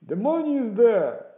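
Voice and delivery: high, very loud answer; shouted reply. A narrator's reading. Man at the window answering the beggar, loud shout. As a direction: holler the answer down from above.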